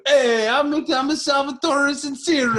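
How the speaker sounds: high, loud mock-Italian accent; Italian impression. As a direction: belt it out as a hokey Italian showman. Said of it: "I did the Italian impression".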